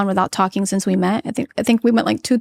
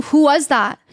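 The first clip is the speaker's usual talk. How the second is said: a touch raised and tense; voice rising; 4 semitones higher than usual. Retold or imitated. Wife in the car to husband, voice slightly raised. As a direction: let the voice rise a little, edgy. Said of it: "then my voice got a little".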